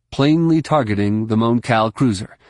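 usual speaking voice

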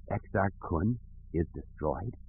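a hoarse, fearful question. A narrator's reading. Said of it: "Kip asked hoarsely".

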